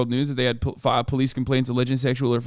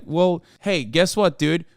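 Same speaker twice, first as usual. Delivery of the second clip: ordinary talk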